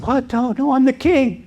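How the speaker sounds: high, startled cry of disbelief; a captured king protesting; alarmed protest. Retold or imitated.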